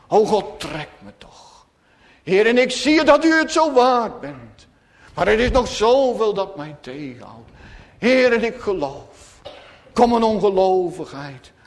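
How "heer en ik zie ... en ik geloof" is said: ordinary talk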